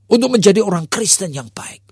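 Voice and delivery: ordinary talk, nothing marked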